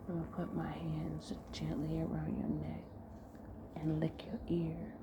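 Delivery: hushed, intimate delivery with long pauses; seductive role-play. Acted. Man giving his partner intimate orders, hushed seduction.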